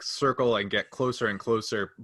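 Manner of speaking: ordinary delivery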